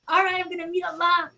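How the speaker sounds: lightly voiced excitement over death; excited. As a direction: sound thrilled and ready. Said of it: "I'm just all excited".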